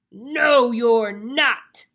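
very loud, scary troll. Acted. Troll refuses the little goat, bellowing. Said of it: "said the troll".

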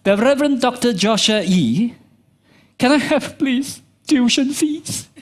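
lightly acted kid begging with stiff formality; overly formal child. Child formally begging pastor father for tuition fees, mock-formal. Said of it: "If one of my kids come to me".

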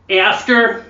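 high, loud American "After" with hard R; American trying and failing at "After"; failed American attempt.

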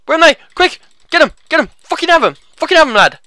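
high, loud bursts of urging; frantic gamer egging on; urgent battle shouting. Real, not acted. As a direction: shout it in the thick of a fight. Urging himself on in a fight.

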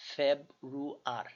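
"ফেব্রুয়ার" stretched very slowly; sounded out slowly. Shown as a demonstration. Tutor slowly sounding out German February for students. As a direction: build "ফেব্রুয়ার" syllable by syllable. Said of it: "বে, ফেব".

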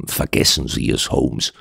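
hushed, near-voiceless aside; whispering. Acted. Doctor warns the detective aside, whispering. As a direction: whisper it softly to a friend. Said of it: "flüsterte ich meinem Freund zu".

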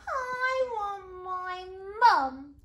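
long, high, loud wail; slow storybook scream, high and loud; screaming princess. A narrator's reading. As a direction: stretch every word into a child's scream. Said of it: "she screamed".

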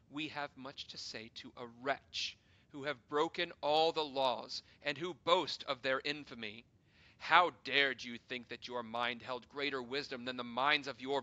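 loud, harsh accusing tirade; furious condemnation. Acted. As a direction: tear into the wretch. Council leader condemns the hero.

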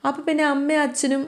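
lightly acted whine of a tearful little girl; on verge of tears. Little girl begging to see her father, mildly tearful. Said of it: "ചിപ്പി കരയാനായിട്ട് തുടങ്ങി".

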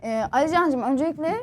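soft, stiff mock-formal thank-you; insincere formal speech. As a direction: deliver a fake polite thank-you speech. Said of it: "samimiyetsiz konuşmamı yapayım".

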